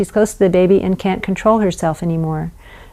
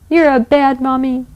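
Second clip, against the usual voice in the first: put-on upset child; 6 semitones higher than usual. Shown as a demonstration. Child voiced accusing.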